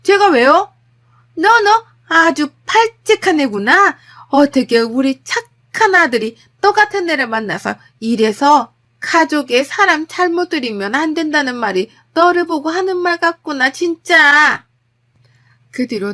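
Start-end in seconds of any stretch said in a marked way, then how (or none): 0.0-0.7 loud, high, defiant retort; shouting back. Acted. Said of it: "저도 참지 않고 질렀습니다". 1.3-14.6 high, loud, theatrical indignation; outraged mother-in-law. Acted. 며느리에게 격분한 시어머니.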